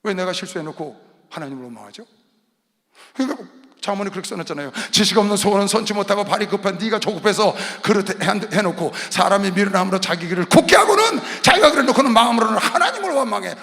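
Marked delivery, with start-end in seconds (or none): 4.9-13.5 loud scripture declamation; forceful preaching. Real, not acted. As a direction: drive the verse home loudly. (힘주어 선포하는 설교)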